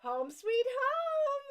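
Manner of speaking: high, loud, drawn-out delight; gushing exclamation. Real, not acted. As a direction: stretch it out slowly, high and loud. Exclaims blanket title with gusto.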